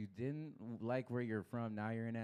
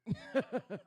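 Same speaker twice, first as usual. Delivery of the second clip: real laugh; 10 semitones higher than usual. Real, not acted. Laughs at own story.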